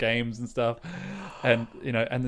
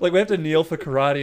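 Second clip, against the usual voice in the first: usual speaking voice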